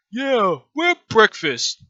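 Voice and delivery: high, loud, slow dim-witted brute; dim lackey creature. Acted. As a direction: draw the words out like an eager simple creature. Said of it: "they're like".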